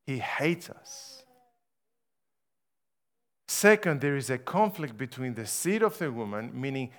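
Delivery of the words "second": emphatic point marker. Acted. Announcing next sermon point.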